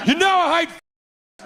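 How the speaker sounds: high, laughing shout; God's angry shout. Acted. God erupts at Brian and his boyfriend, high angry shout. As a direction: explode with outrage.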